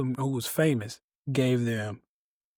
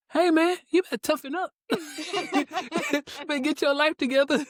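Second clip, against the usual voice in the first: much higher, fast tough-guy scold broken by laughter; laughing mock scold; 13 semitones higher, faster than usual. To himself over emotional songs, a laughing mock tough-guy scold. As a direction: laugh through a hammed-up order to toughen up.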